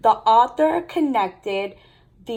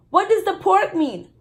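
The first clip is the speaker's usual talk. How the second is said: put-on higher, eager child question; curious sister; 9 semitones higher than usual. A narrator's reading. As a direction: ask like a curious girl. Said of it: "Lissy asked".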